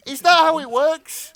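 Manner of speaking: high, loud incredulous question. Real, not acted. Co-host interrupts the absurd story, loud incredulity. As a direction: blurt it out in disbelief.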